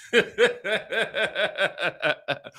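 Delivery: long staccato cackle; re-enacted cackle. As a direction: rattle off a run of laughs, tapering down. Retells laughing reply to partner.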